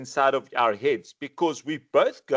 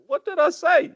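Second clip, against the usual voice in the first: mock bewilderment; 13 semitones higher, 3 dB louder than usual. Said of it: "And then we go".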